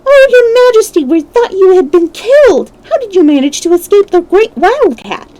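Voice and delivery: very high, loud, anxious squeaks; excited exclaim. A narrator's reading. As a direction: cry out in relief to the queen. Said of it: "they exclaimed".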